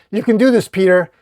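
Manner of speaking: yelled encouragement; high, loud rallying cry; Superman. Acted. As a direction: urge a struggling friend on from afar. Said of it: "as he yells".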